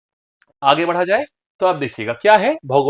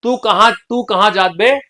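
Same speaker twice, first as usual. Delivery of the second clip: Bundeli demo, louder and drawn out; Bundeli dialect; 4 semitones higher, 3 dB louder, slower than usual. Shown as a demonstration. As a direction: say it slowly in rustic Bundeli, pitched up. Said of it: "ये हो गया आपका बुंदेली". (बुंदेली लहजे में)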